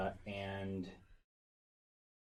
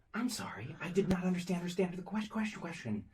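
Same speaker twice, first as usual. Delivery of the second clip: high-pitched zombie with words doubling back; weird zombie echo; 10 semitones higher, faster than usual. Acted. Game master voicing zombies saying the same thing out of sync, weird high echo. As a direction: echo yourself in a high, eerie voice, stuttering the repeats. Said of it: "gives that really weird, like, awful echo".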